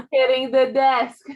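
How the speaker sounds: sharp and loud, scolding. Real, not acted. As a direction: snap it at her again. Repeats scolding louder.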